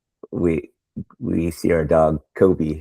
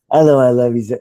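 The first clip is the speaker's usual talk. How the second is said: excited greeting; 5 semitones higher, 6 dB louder than usual. Retold or imitated. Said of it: "Be like".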